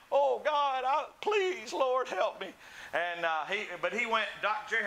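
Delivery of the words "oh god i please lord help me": high-pitched mock pleading; desperate plea. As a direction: beg like a man convicted and wanting to run. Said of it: "he convicted me. I wanted to run saying".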